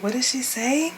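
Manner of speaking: high-pitched, playful double take; mock astonishment. Host replays her reaction to the confession, mock-astonished. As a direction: act out hearing juicy news.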